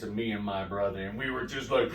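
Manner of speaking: ordinary talk, nothing marked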